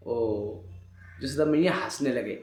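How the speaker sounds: ordinary talk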